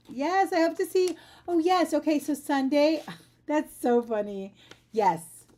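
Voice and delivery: bright, loud, gushing goodbyes; beaming and eager to greet; excited. Real, not acted. Excited goodbyes to stream viewers.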